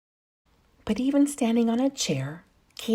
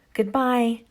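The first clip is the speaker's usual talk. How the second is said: calling out; 3 semitones higher than usual. A narrator's reading. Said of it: "called Katy".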